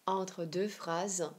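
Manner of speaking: ordinary talk, nothing marked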